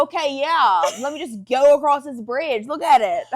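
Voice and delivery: loud mock agreement; retold sarcastic protest, laughing at the end; sarcastic retort. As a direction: push back with loud sarcasm. Sarcastic retort to teammates' urging.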